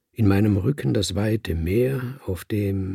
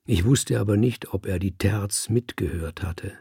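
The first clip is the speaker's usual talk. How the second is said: ordinary delivery, nothing marked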